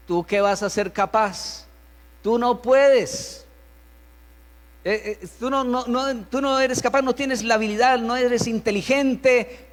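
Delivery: belittling parent. Acted. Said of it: "Subestimación es decirle al niño".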